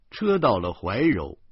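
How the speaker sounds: plain reading voice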